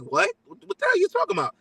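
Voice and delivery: high, fast disbelieving outburst; shocked disbelief. Retold or imitated. Father confronting the friend, shocked high-pitched disbelief. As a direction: leap up in pitch, stunned and rushing. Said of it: "I'm like".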